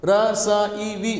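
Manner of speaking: receive wrongly chopped into Ra, sa, e, v; wrongly split up. Shown as a demonstration. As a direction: sound out Ra, sa, e, v piece by piece. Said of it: "No, you can't say that".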